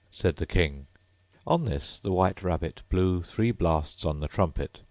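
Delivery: plain reading voice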